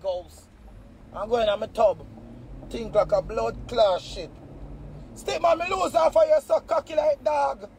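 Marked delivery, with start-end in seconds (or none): none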